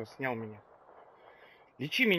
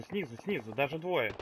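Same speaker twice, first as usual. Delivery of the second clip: ordinary talk, nothing marked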